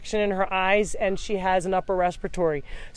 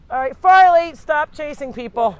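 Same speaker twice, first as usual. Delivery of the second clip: loud, much higher warning call; calling out the dog; 6 semitones higher, 8 dB louder than usual. Real, not acted. Rescuer warns dog Farley to stop chasing people, loud high call. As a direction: pitch it up and out to stop him.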